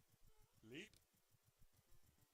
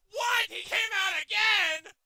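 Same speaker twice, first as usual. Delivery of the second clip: high, loud, incredulous shout; real outburst at the game; outraged disbelief; 10 semitones higher, 11 dB louder, slower than usual. Real, not acted. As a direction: yell it in disbelief. Outraged at enemy's return.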